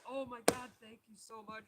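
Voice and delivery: high, gushing relief, loud at first; imitated grateful owner. Owner thanking him for the dog, retold high gush.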